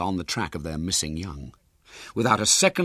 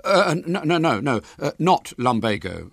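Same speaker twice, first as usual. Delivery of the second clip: hasty; 7 semitones higher, 4 dB louder than usual. Acted. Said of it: "said William hastily".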